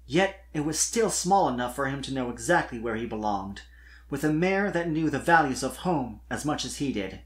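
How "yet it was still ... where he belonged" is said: ordinary delivery, nothing marked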